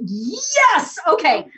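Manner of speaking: high, loud, jubilant yes; hammed-up joyful surrender, near laughing tears; delighted buy-in. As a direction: cheer like you've just been won over. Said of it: "And I went".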